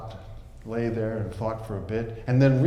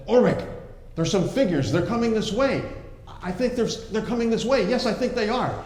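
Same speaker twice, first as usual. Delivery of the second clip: much higher excited calling; excited lookout; 8 semitones higher than usual. Acted. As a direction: spot rescuers in the trees. Klaus spotting figures excitedly.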